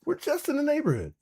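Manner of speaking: high mocking echo of a guy bragging; mocking parrot. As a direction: parrot his line back with disbelief. Repeats Chris's words mockingly.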